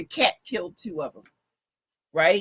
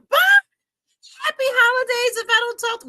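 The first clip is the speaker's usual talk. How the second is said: high singsong goodbye; 6 dB louder than usual. Real, not acted. High sing-song farewell.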